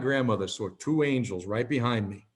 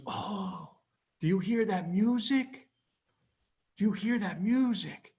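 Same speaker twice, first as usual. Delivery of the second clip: high, hushed wonder; awed, lilting delight as grandma; elated grandmother; 8 semitones higher, 4 dB quieter than usual. Retold or imitated. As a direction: imitate an enraptured old woman. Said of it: "got this elated look on her face".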